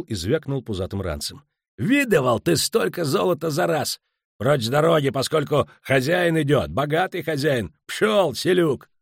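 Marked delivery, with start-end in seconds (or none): 1.8-8.9 higher, loud boastful sneer; arrogant braggart. Acted. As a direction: crow over them like a rich lout. Muus brags and insults.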